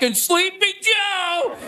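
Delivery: high, slow, drawn-out mock call; lightly imitated rambling politician at a rally; Donald Trump. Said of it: "Trump was just like".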